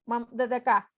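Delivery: normal talking voice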